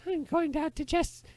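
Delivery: high, slow, put-on boy voice; joke boy voice. Boy answering his uncle by the river, high boyish voice. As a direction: pitch way up as the cartoonish lad. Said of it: "And the boy goes".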